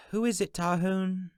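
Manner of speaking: put-on high young woman's voice calling out; Fee calling. A narrator's reading. Fee calls to Tarhoon from the hallway, high voice. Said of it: "Fee called".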